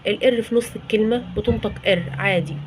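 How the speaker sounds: ordinary talk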